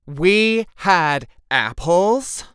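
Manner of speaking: loud, high, drawn-out disbelief; exclaiming. A narrator's reading. Lulu learning there were apples, exclaiming. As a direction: stretch each word out in indignant surprise. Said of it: "Lulu exclaimed".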